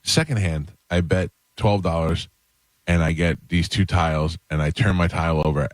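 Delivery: ordinary talk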